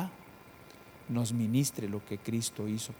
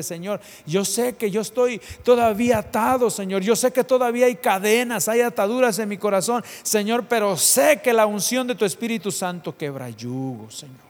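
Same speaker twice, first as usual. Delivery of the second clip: much higher, louder pleading prayer; fervent loud prayer; 7 semitones higher, 7 dB louder than usual. Acted. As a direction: cry out to God to break the chains. Believer praying fervently for freedom.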